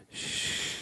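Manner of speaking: soft, drawn-out shush; soft self-shushing. Retold or imitated. Woman telling herself to be quiet, soft shush. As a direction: hush yourself with a quiet shh. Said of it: "would have looked inward and said".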